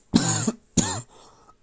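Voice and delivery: a sharp, loud real cough. Real, not acted. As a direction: cough hard, off to the side. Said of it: "You're still gonna hear me cough".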